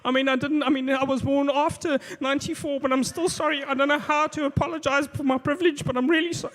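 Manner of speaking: high-pitched mock grovelling apology; over-apologetic.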